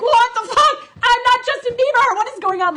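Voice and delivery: high, loud mock outburst of disbelief; shocked disbelief. Overwhelmed by crying fans, loud stunned disbelief. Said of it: "And I was like".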